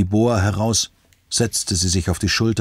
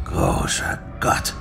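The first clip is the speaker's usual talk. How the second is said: breathless gasp, far lower and slow; 3 dB quieter, slower than usual. Acted. Alistair hearing of the new victims, gasping in shock. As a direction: let it escape on a shocked breath. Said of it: "keuchte Alistair".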